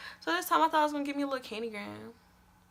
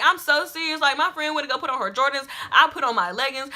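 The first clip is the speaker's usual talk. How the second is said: ordinary talk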